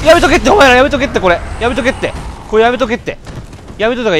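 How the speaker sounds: loud warning. Real, not acted. Shouting at enemies in game.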